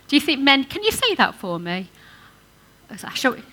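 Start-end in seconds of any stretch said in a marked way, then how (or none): none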